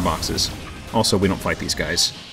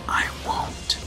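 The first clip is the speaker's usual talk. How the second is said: soft, voiceless whisper; whispered aside; 7 dB quieter than usual. Real, not acted. Host after promising to edit it out, whispering. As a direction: slip it in under the breath, whispered.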